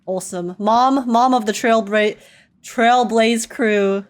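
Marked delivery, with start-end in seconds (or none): none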